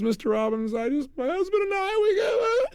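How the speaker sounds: high, tearful, breaking into sobs; crying woman. Retold or imitated. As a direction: imitate her crying through the words. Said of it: "and she starts to cry".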